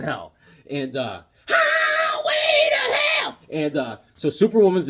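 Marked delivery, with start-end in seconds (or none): 1.5-3.4 long, high, drawn-out monster howl; monster roar. Retold or imitated. Comic fan voicing Doomsday, drawn-out monster howl. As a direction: belt it out like a rampaging beast.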